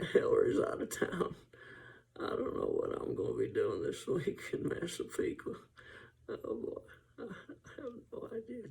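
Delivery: hoarse, airy, high Clinton impression; Bill Clinton. Comic imitating Bill Clinton musing about Hillary, airy and high. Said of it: "Bill Clinton could come in and go".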